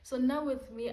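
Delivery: ordinary talk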